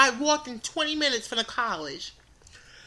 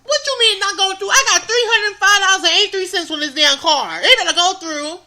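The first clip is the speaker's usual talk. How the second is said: indignant retort; 6 semitones higher, 6 dB louder than usual. Retold or imitated. Arguing with cashier over card.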